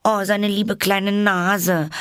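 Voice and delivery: high, slow anguished cry; dismayed wail. A narrator's reading. Alice wailing as a pan flies past the baby. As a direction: wail over the little nose. Pan nearly hits baby's nose.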